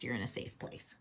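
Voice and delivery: ordinary delivery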